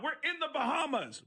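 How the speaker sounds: high, laughing comic outrage; exasperated. Real, not acted. Comic defending his iceberg prank, incredulous. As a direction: protest at how obvious it is, voice way up.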